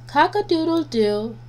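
usual speaking voice

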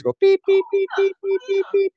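very high beep beep like a pager; beeper imitation. Voice actor imitating a beeper, high beeps. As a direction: chirp the beeps like an old pager. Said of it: "remember when they carried beepers".

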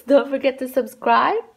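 usual speaking voice

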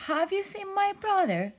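high, loud calling across the water. Acted. Jellyfish calls through the ocean for his brother, high and loud. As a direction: call out for him, searching. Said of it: "kept calling".

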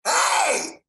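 hollering a name from afar; calling out. Retold or imitated. Calling across the room to Clark, mock shout. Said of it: "who's just, like, completely".

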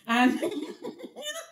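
high, laughing aside. Real, not acted. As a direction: crack up at your own denial. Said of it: "laughing at herself".

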